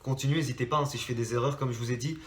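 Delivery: normal talking voice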